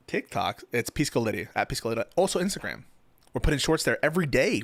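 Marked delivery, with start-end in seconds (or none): none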